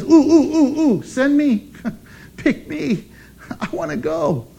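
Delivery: high, giggly, bouncing with eagerness; like a kid begging to be picked, laughing; eager volunteer. Retold or imitated. Isaiah begging God to send him, giddy and laughing. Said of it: "And Isaiah says".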